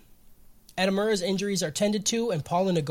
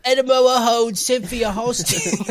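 slow, hammy made-up accent with dropped Ls; unidentifiable accent; 3 semitones higher, 3 dB louder than usual. Shown as a demonstration. Host mocking own slip in the recap, unidentifiable accent. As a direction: put on a silly accent of no known place. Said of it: "I don't know what accent that is".

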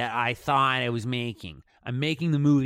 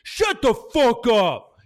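loud, slow, high tough-guy bark; cool inner voice; 11 semitones higher, 9 dB louder, much slower than usual. Retold or imitated. Filmmaker voicing his cool side shutting down doubts, tough. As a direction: drawl it like a swaggering alter ego. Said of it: "Cool [name] was like".

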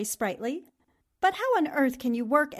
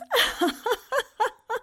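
high, rippling titter; staccato high laugh; giggle; 9 semitones higher than usual. Acted. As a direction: giggle high at your own tease. Laughs at the coincidence.

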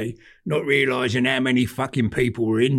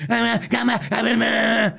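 shouting man; 10 semitones higher, slower than usual. Retold or imitated. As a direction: mimic a punter yelling at the race. Deaf man shouting at the telly.

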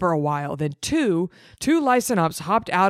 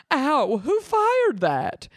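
hurt woman's cry; 8 semitones higher, much slower than usual. Acted. Shot woman cries out.

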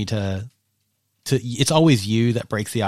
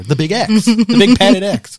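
amused emphasis; 10 semitones higher, 10 dB louder than usual. Real, not acted. Laughing emphasis on euphemism.

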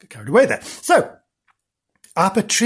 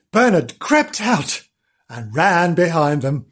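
ordinary delivery

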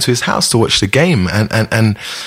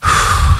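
long release sigh. Shown as a demonstration. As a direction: let the breath out in one long sigh. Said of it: "So you'll go".

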